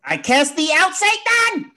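high, loud hammed-up exorcism cry; mock exorcist. Host jokingly casts out Satan in a high loud cry. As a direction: banish the devil like a preacher.

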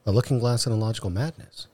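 ordinary talk, nothing marked

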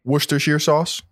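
Worcestershire said "WUUS-ter-sheer"; alternative pronunciation. Shown as a demonstration. Co-host offering another version, Worcestershire as "WUUS-ter-sheer". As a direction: end Worcestershire in a clear "sheer", questioning.

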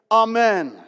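loud, firm amen; called amen. Shown as a demonstration. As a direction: call it out like the congregation. Showing the congregation's amen.

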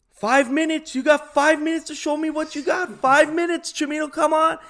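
loud, high-pitched yell of a coach pushing players; demanding coach shouting. Retold or imitated. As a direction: bellow it across the field, imitating the coach. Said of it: "You, you pushed me".

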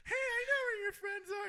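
very high put-on kid; cartoonish little-kid falsetto; small child's voice. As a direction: lure them in with a squeaky child voice. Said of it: "just keep up that voice".

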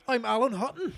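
high, fast mock Scottish accent; a clumsy Scottish footballer impression; bad Scottish accent. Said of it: "That wasn't very good Scottish".

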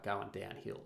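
usual speaking voice

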